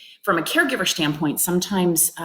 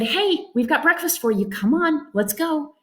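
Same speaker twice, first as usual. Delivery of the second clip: brisk, pushy, no choice given; herding her along briskly; bossy rushing; 8 semitones higher than usual. Retold or imitated. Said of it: "I just said".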